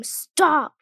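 loud sudden scream of stop. A narrator's reading. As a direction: yell it at full voice. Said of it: "Then I scream".

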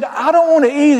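high, loud and eager, voicing a friend; fired-up quote. Preacher quoting his friend at lunch, high and fired up. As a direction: throw your voice up high with a preacher's fire. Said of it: "He said".

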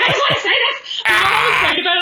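very high, tearful fangirl squeal through laughter; squeaky, laughing mock-emotional voice; exaggerated gushing. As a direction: ham up your own embarrassing gush. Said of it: "Is that, is that how you sounded?".

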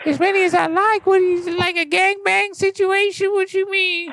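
very high cartoon rapper voice; Lil P P voice. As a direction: talk like a helium-pitched rapper. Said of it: "I'll put him on the phone".

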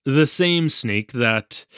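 usual speaking voice

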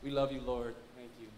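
soft, low worship murmur; real prayer. Real, not acted. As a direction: pray tenderly, pitched well down. Preacher turns aside to pray.

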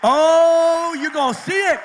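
loud, slow preaching climax; fiery preaching. Acted. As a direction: shout it out like a revival preacher. Preaching at full force.

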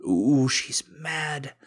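loud, slow, high ooh of teasing alarm; hammed-up taunting ooh; mock alarm. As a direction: tease her for being mad, drawn out. Teasing wife who is angry.

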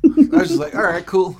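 laughing. Real, not acted. Laughing while quoting herself.

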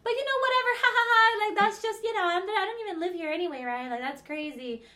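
put-on high, loud, excited girly gush; giddy friend impression. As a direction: gush like a smitten friend, singsong. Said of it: "she was like".

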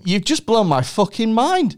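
high-pitched, astonished outburst; amazed. Real, not acted. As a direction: react as if floored by it. Amazed by the bee fact.

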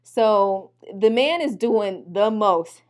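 ordinary talk

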